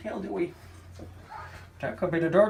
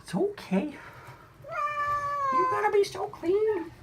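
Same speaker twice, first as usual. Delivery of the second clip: soothing a scared cat. Real, not acted. Calming cat in the bath.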